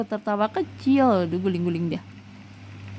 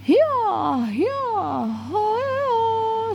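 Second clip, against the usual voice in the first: high ringing herder's cry; long high "hia" shouts; herding call; 14 semitones higher, much slower than usual. Acted. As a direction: call the cattle from the sky. Herders calling out to cattle.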